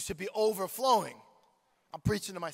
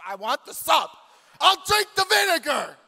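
high, loud, shouted craving; loud high shout for the sub; comic shout; 10 semitones higher, 12 dB louder than usual. Acted. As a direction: yell it like a starving man. Hyperbolic preacher's shout about subs.

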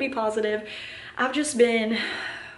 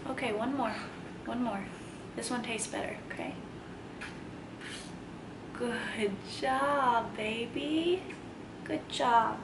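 soft, hushed mommy voice; gentle coaxing; 10 dB quieter, slower than usual. Real, not acted. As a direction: coax a little one tenderly, very softly. Soothing toddler while giving something.

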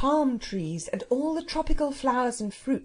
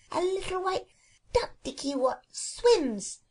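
put-on happy toddler voice; 9 semitones higher than usual. Small child on a sunny beach calling himself a duck, happy toddler voice. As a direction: chirp it like a delighted toddler.

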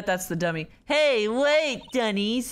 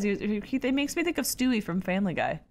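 ordinary talk, nothing marked

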